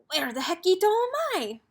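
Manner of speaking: high-pitched, puzzled kitten voice; wondering aloud. A narrator's reading. Kitten lost at the end of the world, wondering aloud. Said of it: "wondered Skipito aloud".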